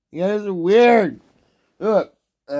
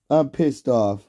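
ordinary delivery

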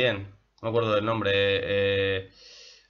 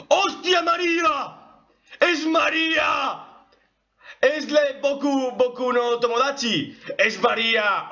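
very high, excited squealing cries; thrilled recognition; 11 semitones higher than usual. Real, not acted. Anime fan discovering who voices María, thrilled. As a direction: shriek it like a fan finding a favourite.

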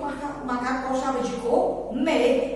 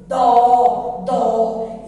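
"Dó" twice, low, loud and held; reading by syllables; 3 semitones lower, 7 dB louder than usual. Shown as a demonstration. Teacher answering which syllable it is, drawn out. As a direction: hit and stretch each "Dó". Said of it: "E essa sílaba?".

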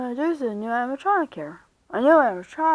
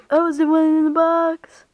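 high, loud puppet voice; insistent marionette character; 5 semitones higher, 8 dB louder than usual. Acted. Marionette reminding Bonnie who it is, high insistent voice.

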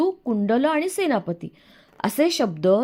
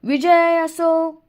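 long drawn, high, loud cheer; joyful cheering response; 8 semitones higher, 5 dB louder, much slower than usual. A narrator's reading. All the children answering the royal salute, joyful cheer. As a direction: chant it out, stretched and ringing. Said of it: "अतिशय आनंदानं त्याला प्रतिसाद दिला".

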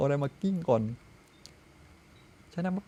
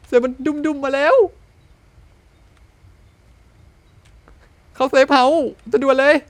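higher, louder alarmed calling; urgent shout; 6 semitones higher, 9 dB louder than usual. Real, not acted. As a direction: yell to run for safety. Tiger charging, run.

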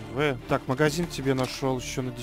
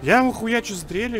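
indignant rant; 8 semitones higher than usual. Real, not acted. Ranting after being killed.

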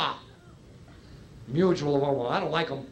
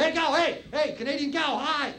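excited greeting; 7 semitones higher than usual. Retold or imitated.

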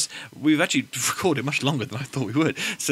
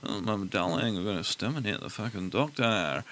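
put-on Californian accent; 5 semitones lower, 7 dB quieter than usual. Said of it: "I'll do a California one".

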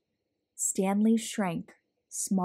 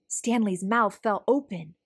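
ordinary delivery

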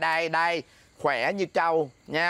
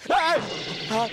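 startled yelp; 8 semitones higher than usual. Acted. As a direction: jump out of your skin. Husband startled by wife suddenly there.

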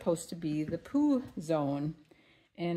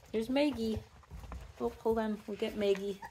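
normal talking voice

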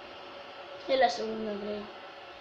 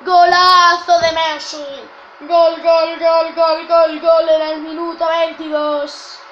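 loud, drawn-out goal chant; goal commentary; 6 dB louder, slower than usual. Acted.

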